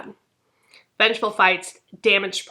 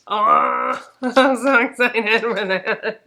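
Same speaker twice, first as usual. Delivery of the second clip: giggly excitement; 2 semitones higher, 3 dB louder, much slower than usual. Real, not acted. Gushing over a book.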